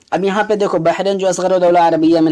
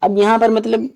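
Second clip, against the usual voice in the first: normal talking voice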